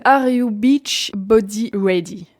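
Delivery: slow English title in a French accent. Real, not acted. Vlogger announcing the third ad's title, French-accented English. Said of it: "Enregistrez bien cet accent".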